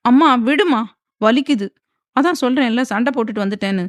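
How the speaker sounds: irritated daughter being hit. A narrator's reading. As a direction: snap back at mum, hurting. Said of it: "கடுப்பாக சொல்ல".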